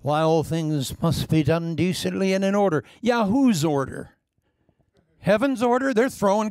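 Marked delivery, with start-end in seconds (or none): 0.0-2.8 prissy mock voice; sarcastic mock of critics; mocking prim objection. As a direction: parody the stuffy critic.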